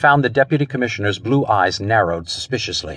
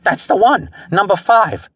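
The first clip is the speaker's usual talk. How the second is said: high, agitated confirmation; stammered; 10 semitones higher, 3 dB louder than usual. A narrator's reading. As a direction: insist in a high, rattled voice. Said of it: "the man stammered".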